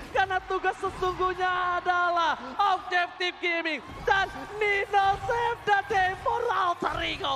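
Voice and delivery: slow, drawn-out high hype call; excited announcer call. Real, not acted. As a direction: stretch out the hero moment. Caster calling the game-saving play.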